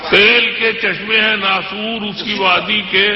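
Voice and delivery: measured, declamatory poetry recital; stately nazm recitation. Acted. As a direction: recite each couplet with weight. Recites couplet.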